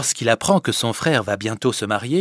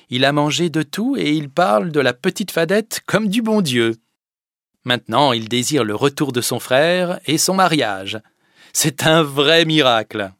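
higher, louder joyful report; delighted mother; 5 semitones higher, 8 dB louder than usual. Acted. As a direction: tell the husband the good news. La mère Barbeau à son mari. (la mère Barbeau, ravie)

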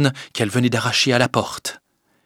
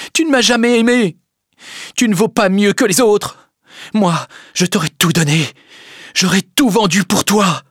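loud, high, anguished accusation; impassioned, wounded outburst; desperate reproach; 10 semitones higher, 4 dB louder than usual. A narrator's reading. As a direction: hurl the hurt at a lover. Desperate lover's reproach after refusal.

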